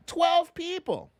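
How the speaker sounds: can't believe the number, say it up high and slow; disbelief. Real, not acted. Stunned by misinformation statistic.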